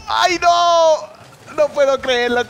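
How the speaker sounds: high, loud wail of dismay; real agonized shout; anguished disbelief. Real, not acted. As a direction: cry out as the goal slips away. Ball fails to go in.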